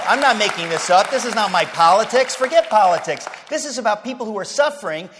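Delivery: loud, urgent pulpit warning; preaching at full force. Acted. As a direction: hammer it home to the congregation.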